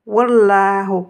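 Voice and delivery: Wallahu lié lentement, le waw collé au l; avec la wasla. Shown as a demonstration. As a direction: liez le waw à Allahu sans coupure. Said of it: "vous aurez la wasla". (Wallahu lié par la wasla, lentement)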